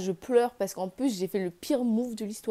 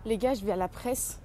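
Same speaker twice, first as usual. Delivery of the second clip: normal talking voice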